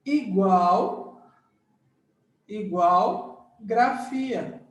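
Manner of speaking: igual grafia said very slowly; slow emphasis. Shown as a demonstration. Teacher slowly drilling the meaning to students. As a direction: spell out igual grafia word by word. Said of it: "teacher spells out the meaning".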